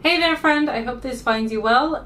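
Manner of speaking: normal talking voice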